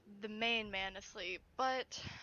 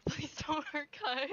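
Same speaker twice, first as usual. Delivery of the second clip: higher, half-laughing plea; nervous pleading; 6 semitones higher, 5 dB louder than usual. Pretend. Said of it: "I'm so scared".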